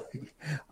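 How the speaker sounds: a quick burst of real laughter; short laugh. Real, not acted. Laughing before explaining about his grandfather. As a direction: laugh it off, amused.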